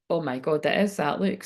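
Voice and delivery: ordinary talk, nothing marked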